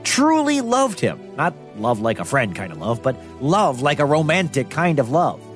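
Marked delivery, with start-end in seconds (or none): none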